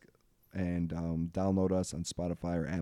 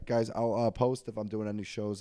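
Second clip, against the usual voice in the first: ordinary talk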